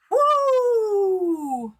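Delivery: high whoop sliding down; loud whoop. Real, not acted. Streamer reacting to dog letting one rip, loud whoop. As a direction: let out a long, loud "woo".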